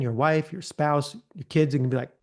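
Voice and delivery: ordinary talk, nothing marked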